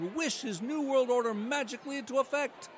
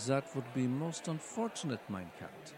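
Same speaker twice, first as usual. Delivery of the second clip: put-on much lower, German-accented villain voice; Klaus Schwab impression; 9 semitones lower, 4 dB quieter than usual. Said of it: "Klaus Schwab".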